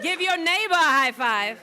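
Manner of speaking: excited call. Real, not acted. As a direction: pump up the crowd. Rousing crowd call.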